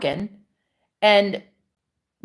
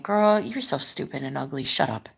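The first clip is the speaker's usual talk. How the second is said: low, soft mock put-down; imitated haters; 6 semitones lower, 7 dB quieter than usual. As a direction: quote the haters low, sinking towards a whisper. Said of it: "people that just tell me".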